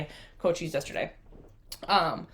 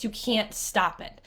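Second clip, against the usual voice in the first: ordinary talk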